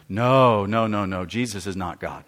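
a lightly voiced caller, low and soft; hint of an insistent caller; mock caller. Pastor imitating the caller softly and firmly. Caller denies Jesus is God.

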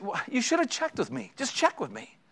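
a hint of a testy husband; retold annoyed rebuke. Husband to wife about the kids' plans, mild rebuke. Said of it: "I said".

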